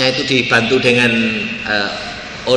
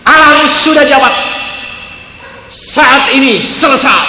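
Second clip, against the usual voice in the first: God proclaiming the prayer is answered; 12 semitones higher, 10 dB louder than usual. Retold or imitated. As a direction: boom out God's answer. Voicing God's answer.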